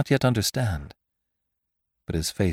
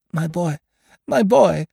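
high, pleading call, growing louder; entreaty; 10 semitones higher, 6 dB louder than usual. A narrator's reading. Victoire to raging Lupin, high entreaty. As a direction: plead with him, voice rising. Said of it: "in a voice of entreaty".

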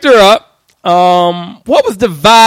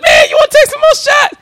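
much higher, laughing as he offers; pushy high voice. Retold or imitated. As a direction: coax her to drink more. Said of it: "you feeding it".

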